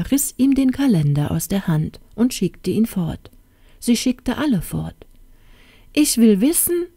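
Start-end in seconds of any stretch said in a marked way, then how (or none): 5.9-6.9 high, insistent exclamation; crying out. A narrator's reading. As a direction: exclaim it as a royal demand. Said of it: "rief sie aus". (hoch und fordernd ausgerufen)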